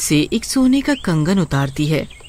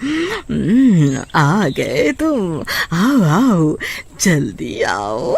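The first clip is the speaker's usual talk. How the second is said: slow, drawn-out witch welcome; savouring witch, slowly beckoning; much slower than usual. Acted. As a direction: draw each word out, luring him closer. Witch lures Bhuvan in.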